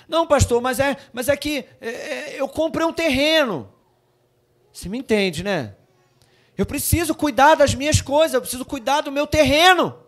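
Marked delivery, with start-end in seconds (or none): 0.1-3.7 higher whiny excuse voice; lightly voiced whiny believer; mock excuse. Mocking congregant's excuse. 6.6-10.0 higher whiny excuse voice; lightly voiced whiny believer; mock excuse. Mocking congregant's excuse.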